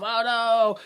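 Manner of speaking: normal talking voice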